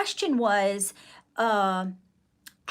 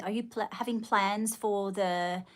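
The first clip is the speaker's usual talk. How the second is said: normal talking voice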